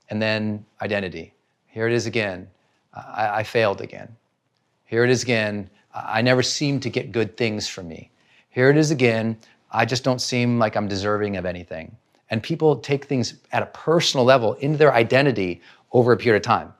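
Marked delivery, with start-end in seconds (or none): 1.7-12.0 defeated self-talk. Retold or imitated. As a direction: lower and softer, a hint of a despondent inner voice. Self-blaming inner voice.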